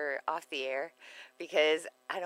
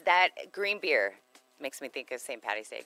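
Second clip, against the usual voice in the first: normal talking voice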